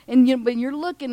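usual speaking voice

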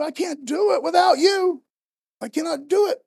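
loud, high cry of need; desperate plea. Acted. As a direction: beg God not to leave you. Moses pleading with God.

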